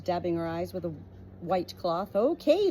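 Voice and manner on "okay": high bleated okay; bleating sheep. Acted. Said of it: "the sheep bleated".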